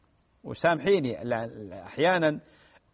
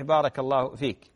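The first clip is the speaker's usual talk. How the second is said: ordinary talk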